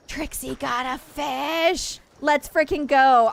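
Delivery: high, drawn-out celebration; excited cheer. Real, not acted. Celebrates a catch in the game.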